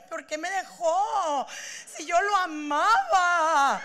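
hammed-up weepy wail, slow; mock crying. Mocking someone still crying over an ex, hammed-up weepy wail. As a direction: whine it like a jilted lover.

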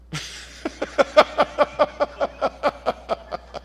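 high breathy laugh; a high, airy burst of laughter. Real, not acted. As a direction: laugh at his own punchline.

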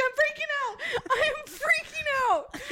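very high panicked squeal; squeaky hammed-up freak-out; mock screaming. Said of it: "I'm screaming".

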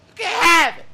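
high, loud belted shout; imitating the singer's yell. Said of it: "just be like".

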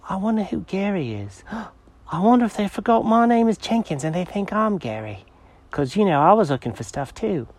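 high, quiet wondering cat; musing cat voice. Acted. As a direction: think aloud as a puzzled cat. Jenkins softer musing.

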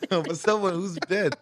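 laughing through the words; laughing in disbelief. Real, not acted. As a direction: repeat it cracking up. Repeats it laughing.

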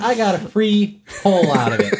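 triumphant high shout; loud defiant boast. Acted. Defiant retort in argument.

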